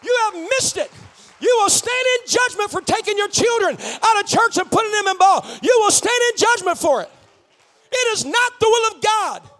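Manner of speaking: loud, high, shouted preaching; fired-up shouted sermon; full-force preaching. Real, not acted. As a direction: preach it out at full volume to the congregation.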